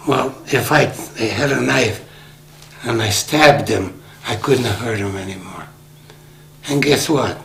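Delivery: usual speaking voice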